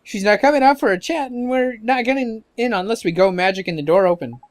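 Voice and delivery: fairy sidekick. Acted. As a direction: sidekick talking down the boy's plan.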